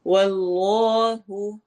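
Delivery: واللہ with a thick heavy لام; heavy Allah. Shown as a demonstration. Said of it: "جلالہ کو موٹا پڑھیں گے". (اللہ کو موٹا پڑھیں)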